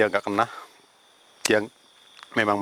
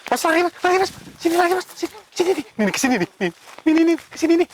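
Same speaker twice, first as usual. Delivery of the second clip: high, loud frantic yelling to the cameraman to run; panicked shouting; 5 dB louder than usual. Real, not acted. Panicked shouting during ghost encounter. (teriakan panik keras dan tinggi)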